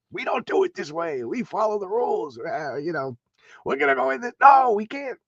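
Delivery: high, huffy mock general; stuffy jerk general. Jerk general refusing the heroes, huffy and high. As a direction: play a rule-bound brass stickler, lightly. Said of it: "the general that's just a jerk".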